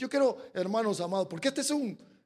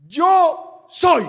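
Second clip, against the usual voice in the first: loud, higher proclamation; proclaiming voice of God; 8 semitones higher, 16 dB louder than usual. Acted. The Lord answering Saul, booming. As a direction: declare it with full power. Preaching at full force.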